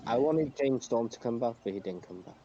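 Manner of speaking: ordinary delivery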